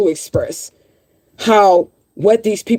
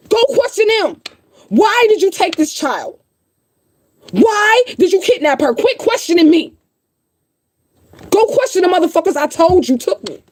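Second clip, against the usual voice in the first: raised, heated demand; real frustration spilling into a raised voice; angry outburst; 8 semitones higher than usual. Real, not acted. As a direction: turn on the critics and insist, pitched up high. Demanding critics question her kidnappers.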